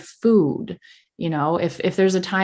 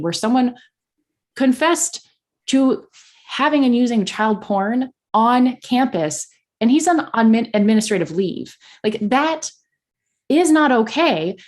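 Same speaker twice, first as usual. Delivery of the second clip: raised, higher-pitched outrage; appalled, voice lifted well above usual; real indignation; 7 semitones higher, 4 dB louder than usual. Real, not acted. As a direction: stress it with mounting disbelief and indignation. Outraged account of campus abuse case.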